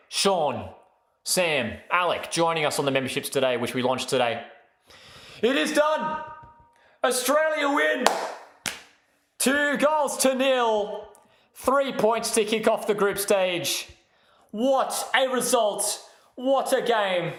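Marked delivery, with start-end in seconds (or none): 5.4-17.3 much higher, slow, drawn-out commentator call; jubilant final whistle call. Real, not acted. Streamer calling Australia's World Cup win, jubilant slow call. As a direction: stretch every word like the winning call on air.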